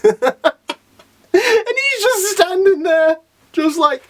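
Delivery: high, slow, squeezed through laughter; laughing disbelief. Real, not acted. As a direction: barely get it out for laughing. Laughing at the unmoved hero.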